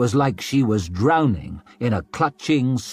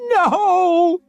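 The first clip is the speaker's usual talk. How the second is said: very high, loud crying no; a high, loud sobbing scream; 6 dB louder than usual. A narrator's reading. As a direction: sob it out desperately. Dragged away, crying.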